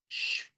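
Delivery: soft unvoiced "sh"; shushing sound. Shown as a demonstration. Teacher showing German SP sound, soft shush. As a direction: hiss out a quiet sh. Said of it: "también lleva ese sh".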